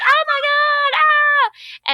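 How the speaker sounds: high, loud panicked shriek; mock freakout. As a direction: imitate someone freaking out in a loud high scream. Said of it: "You're like".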